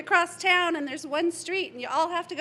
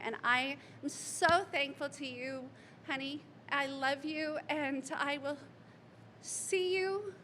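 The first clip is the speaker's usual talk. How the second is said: soft, tender goodbye, softer; grieving mother's gentle goodbye; tender farewell; 8 dB quieter than usual. Real, not acted. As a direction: speak softly to him directly.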